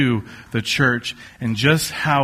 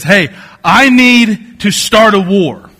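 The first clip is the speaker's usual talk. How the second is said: loud, higher, drawn-out hammed-up outrage; mock mad demand; 5 semitones higher, 7 dB louder than usual. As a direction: act out an indignant call to arms, slowly and loudly. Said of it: "gets so mad".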